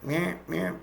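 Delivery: high-pitched mock bird calls; imitated crow chirping. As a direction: imitate a crow's repeated call.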